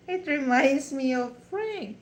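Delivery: laughing through the words; amused, laughing aside. Real, not acted. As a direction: chuckle while remembering Frank. Laughing at the memory.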